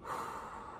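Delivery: soft slow exhale; long quiet out-breath; deep calming breath. Shown as a demonstration. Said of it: "You model the calming technique".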